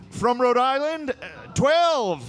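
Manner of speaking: high-pitched hesitant announcement; congressman going first. Acted. Congressman going first on age of consent, high mock-formal call. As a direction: put on a high voice for an awkward delegate. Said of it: "some guy coming up first".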